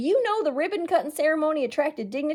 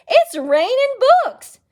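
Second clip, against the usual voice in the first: much higher, louder thrilled cry; excited child; 13 semitones higher, 10 dB louder than usual. Acted. Child spotting books falling from the sky, excited cry. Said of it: "a child said excitedly".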